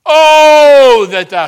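loud, high drawn-out "oh" in prayer; serious, sincere cry. Retold or imitated. Preacher repeating Jabez's prayer to show he's serious, loud cry. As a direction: implore heaven at full voice. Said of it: "he's serious about this thing".

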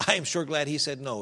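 ordinary talk, nothing marked

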